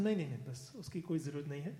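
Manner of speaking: quick Hindi refusal. Retold or imitated. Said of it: "I said".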